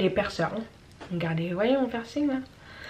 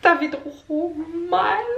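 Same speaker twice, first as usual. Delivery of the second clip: mock pained whine; 10 semitones higher, 4 dB louder, much slower than usual. Retelling pain at the dentist.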